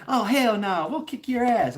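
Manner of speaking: mock tough girl. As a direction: puff up and threaten like a teen gangster. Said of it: "She's like".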